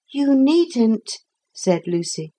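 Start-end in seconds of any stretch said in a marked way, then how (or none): 0.1-1.2 put-on high-pitched girl's reply; girl character. A narrator's reading. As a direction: answer as a child, voice well up. Narrator voices the girl.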